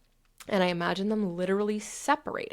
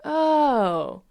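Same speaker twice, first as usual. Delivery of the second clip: long, higher, louder drawn-out oh; relieved realization; 6 semitones higher, 5 dB louder than usual. Acted. Coach acting out her body letting go, dawning relief. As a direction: let a drawn-out oh slide down. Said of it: "And then I'm like".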